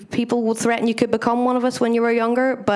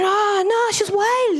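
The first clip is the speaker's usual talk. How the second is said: much higher, shocked pitch; mock shocked reply; 10 semitones higher, much slower than usual. Telling the room what she said about granny, in a high mock shock. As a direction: squeal it like you can't believe her. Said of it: "I said".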